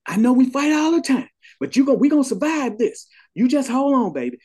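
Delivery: retold yelling. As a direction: yell it up high, begging him to hold on. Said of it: "she's steady yelling at him".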